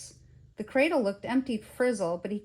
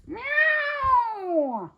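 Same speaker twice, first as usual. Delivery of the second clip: long, high, loud meow; cat yowl; 6 dB louder than usual. A narrator's reading. Cat under the rocker during the row, loud yowl. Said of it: "Cora yowled".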